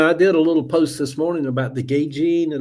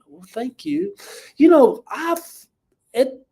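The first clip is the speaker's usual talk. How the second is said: flattered; 11 semitones higher, 5 dB louder than usual. Real, not acted. Pleased by a compliment.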